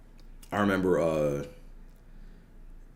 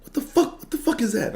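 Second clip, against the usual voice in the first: angry coach yelling. Retold or imitated. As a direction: scream at the rookie to get off the field. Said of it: "Coach Osborne says".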